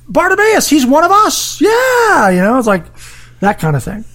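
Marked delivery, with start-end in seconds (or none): 0.1-2.3 high, cheering shout; proud crowd shout. Retold or imitated. Crowd proudly claiming Bartimaeus, high cheering shout. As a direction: claim him proudly as their own.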